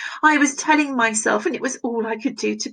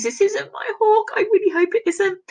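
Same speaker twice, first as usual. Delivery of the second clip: dismayed denial; 11 semitones higher than usual. Acted. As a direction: refuse it with a high, pressed voice. Reading a dismayed quote aloud.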